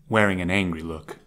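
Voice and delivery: usual speaking voice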